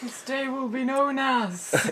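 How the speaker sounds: slow, hammed-up big speech; mock president. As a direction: drag out the grand line.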